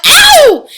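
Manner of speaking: loud, high yelp of pain; boy's yelp. A narrator's reading. Bruno yelping as the spell takes hold. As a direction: yelp like you've been stabbed by a pin.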